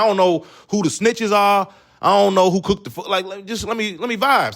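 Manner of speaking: high, pleading new guy on his first week; nervous new worker. Retold or imitated. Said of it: "And you like".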